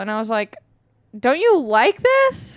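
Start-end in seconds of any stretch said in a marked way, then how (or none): 1.2-2.6 high, slow, incredulous retort; mock affronted question. Comedian replying to gas station guy, mock affronted. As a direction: stretch it into a put-on protest. Acts out her reply to stranger.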